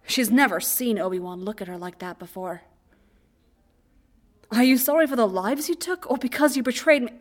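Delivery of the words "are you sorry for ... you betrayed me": higher, louder accusation; accusing. A narrator's reading. Obi-Wan angrily confronts Anakin about betrayal. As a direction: demand it with hurt anger.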